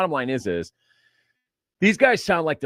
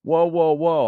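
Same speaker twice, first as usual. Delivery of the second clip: loud rising whoas to slow him down; higher and louder, reining a friend in; calling him down; 6 semitones higher, 6 dB louder, slower than usual. Retold or imitated. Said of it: "I'm like".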